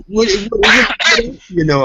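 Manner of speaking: high, loud, slow mock excitement; impressed girls. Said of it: "And they're like".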